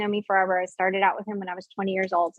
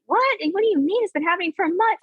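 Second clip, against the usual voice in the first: much higher, astonished "What?"; shocked disbelief; 9 semitones higher than usual. Retold or imitated. Husband hearing it's been months, shocked. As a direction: voice the husband's stunned surprise.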